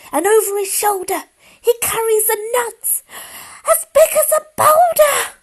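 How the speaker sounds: put-on a child panicking at the shadow; rising frightened child voice; growing fright. Child sees a terrible shadow.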